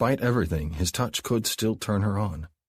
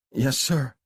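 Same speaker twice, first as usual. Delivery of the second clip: timid yes sir; 8 semitones higher than usual. Acted. Abby cowed after his threat.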